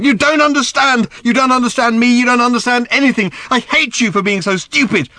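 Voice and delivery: high, loud tirade; angry shout. A narrator's reading. Daughter shouts that she hates her father, furious. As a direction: hurl it at him at full pitch.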